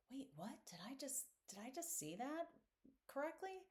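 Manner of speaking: puzzled, halting disbelief, pitch climbing at each phrase end; retold did-I-really-see-that reaction; baffled double take. Retells own disbelieving double take.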